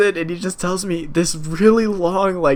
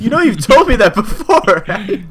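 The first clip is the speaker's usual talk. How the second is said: laughing; 6 semitones higher, 6 dB louder than usual. Retold or imitated. Teasing the customer, laughing.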